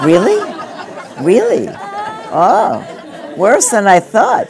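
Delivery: real laughter breaking into the words; laughing. Real, not acted. Laughing at an audience remark.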